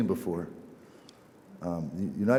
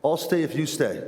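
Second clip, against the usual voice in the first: higher-pitched retold dare; acted challenge; 5 semitones higher than usual. Old man dares his neighbour to stay, acted challenge. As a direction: throw it down as a dare.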